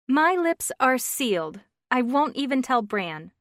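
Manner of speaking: lightly voiced chatty friend; flustered friend on the phone; Stephanie, chatty friend.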